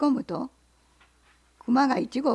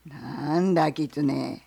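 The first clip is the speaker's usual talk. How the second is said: bear; 9 semitones lower, much slower than usual. Acted. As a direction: growl the question like a bear. Bear voiced.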